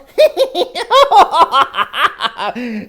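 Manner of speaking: a high, put-on giggle; high laugh. Reading the kids the jokes part, giggling high. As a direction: giggle along with the funny jokes.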